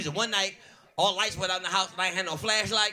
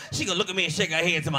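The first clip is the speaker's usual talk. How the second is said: normal talking voice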